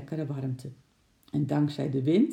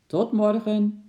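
high, loud farewell call; 6 semitones higher, 7 dB louder, slower than usual. A narrator's reading. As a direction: sing out a goodbye across distance. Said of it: "riep oom Nijltje".

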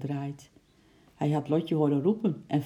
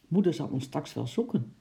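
usual speaking voice

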